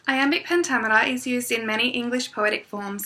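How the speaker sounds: ordinary talk, nothing marked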